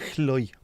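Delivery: "Chloi" with a long rasped Welsh opening; pronunciation attempt. Shown as a demonstration. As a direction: test just the first syllable, "Chloi". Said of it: "How the freaking hell do you pronounce that?".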